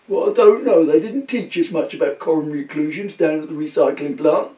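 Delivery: high put-on voice, dripping sarcasm; a wife snapping back at her husband in a high comic voice; sarcastic wife.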